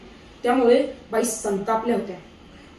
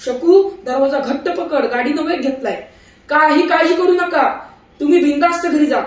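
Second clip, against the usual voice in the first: shouting while running; 4 semitones higher, 7 dB louder than usual. A narrator's reading. Husband runs alongside train shouting to wife, loud. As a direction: call out running beside the train. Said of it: "धावत धावत ओरडले".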